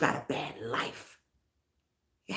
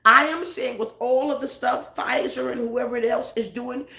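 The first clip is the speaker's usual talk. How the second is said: ordinary talk, nothing marked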